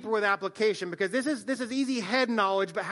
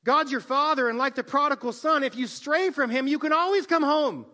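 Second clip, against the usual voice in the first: hypothetical sermon ending; 5 semitones higher, 5 dB louder than usual. Retold or imitated. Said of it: "I could have said".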